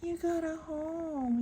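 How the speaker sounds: high, slow sing-song coo. Real, not acted. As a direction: stretch it sweetly to a pet. Cooing to kitten.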